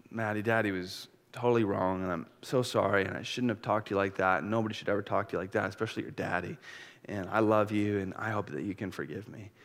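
soft, low, sincere apology; tender apology. Retold or imitated. Dad apologizing to his daughter at eye level, soft and tender. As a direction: kneel and say sorry gently.

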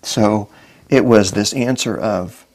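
normal talking voice

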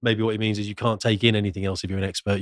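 usual speaking voice

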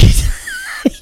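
breathless squeak of laughter; high wheezing laugh. Real, not acted. Cracking up again.

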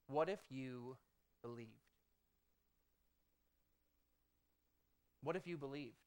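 soft, low, hushed question; the Lord speaking in a vision, softly. Retold or imitated. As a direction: let it fall almost to a breath. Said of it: "and he said".

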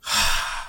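long, voiceless exhale; acted sigh. American guy after being called a Nazi, mock sigh. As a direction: let out an imitated weary sigh.

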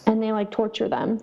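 usual speaking voice

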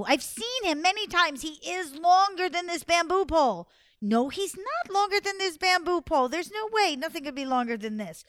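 high, loud pretend bickering; mock argument. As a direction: insist loudly, as in a staged squabble. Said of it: "pretended to have an argument".

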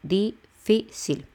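difícil split as DI, FÍ, CIL with pauses; difícil one syllable at a time. Shown as a demonstration. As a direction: break difícil into three slow beats. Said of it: "la penúltima sería el fi".

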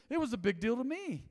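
normal talking voice

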